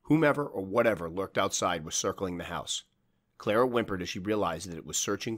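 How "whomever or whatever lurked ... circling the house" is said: ordinary delivery, nothing marked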